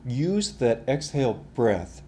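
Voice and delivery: normal talking voice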